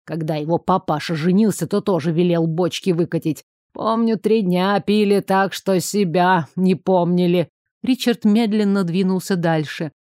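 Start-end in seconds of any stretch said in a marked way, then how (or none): none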